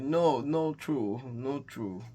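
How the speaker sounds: slow, fading sad imitation. Man mourning his late wife, mildly sad. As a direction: let the denial sink away softly, slowly. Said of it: "And he's very sad".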